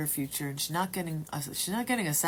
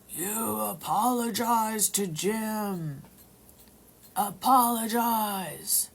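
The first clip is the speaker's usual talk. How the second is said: slow, higher, demanding spirit voice; a spirit commanding through her mouth, slow and eerie; possessed voice; 6 semitones higher, 5 dB louder, much slower than usual. Retold or imitated. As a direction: drag it out like something else speaks through you. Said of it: "this voice comes out of my mouth".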